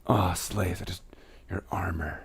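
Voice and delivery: hushed mock swoon; swooning admirer. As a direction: gush softly, lovestruck. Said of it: "And you're like".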